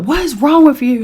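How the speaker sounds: husband bursting in yelling; alarmed shout. Retold or imitated. Ex-husband rushes in.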